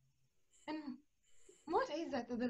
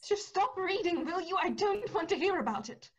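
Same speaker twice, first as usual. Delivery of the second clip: loud and higher, snapping at her to stop; angry outburst; 5 semitones higher, 5 dB louder than usual. Acted. Helena to the nurse reading bad news: loud angry plea. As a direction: shut her up, raised voice.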